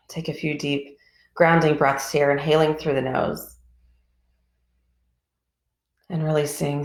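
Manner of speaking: calm, even voice with long pauses between phrases; guided meditation. Real, not acted. Teacher leading a group body scan from toes upward, calm guided meditation.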